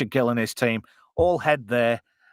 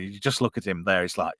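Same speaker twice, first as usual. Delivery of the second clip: ordinary talk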